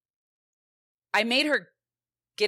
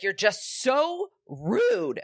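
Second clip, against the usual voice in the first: higher, drawn-out whine of a rude accusation; exaggerated sulky daughter, pitched up; mock bratty kid; 7 semitones higher, much slower than usual. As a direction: imitate an affronted kid, dragging the words. Imitates her daughter's morning attitude.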